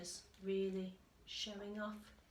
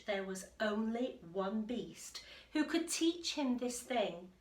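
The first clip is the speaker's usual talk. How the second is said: ordinary delivery, nothing marked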